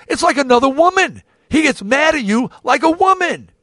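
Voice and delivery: high, loud exclaiming as the wife; retold exasperated wife. Retold wife exclaiming about his friend.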